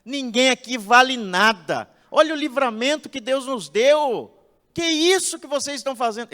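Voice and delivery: loud, high reproach of the ungrateful people; the leader Moses did not become, shouting insults; angry scolding. Pretend. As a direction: berate them furiously. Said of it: "Ele não disse".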